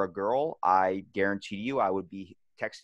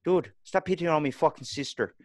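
imitated Irish guy; 5 semitones higher than usual. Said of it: "this Irish guy I worked with".

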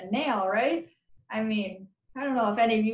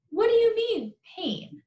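scoffing; 12 semitones higher than usual. Acted. Friend scoffing at the question.

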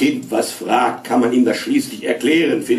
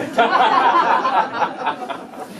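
mocking laughter; 10 semitones higher than usual. A narrator's reading.

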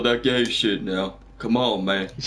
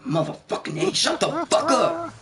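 mock fed-up outburst rising high; hammed-up annoyance without extra volume; retold exasperation; 13 semitones higher than usual. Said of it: "how mad I was getting".

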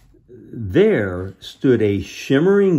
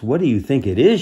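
plain reading voice

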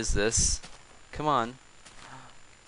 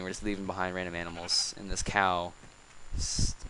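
usual speaking voice

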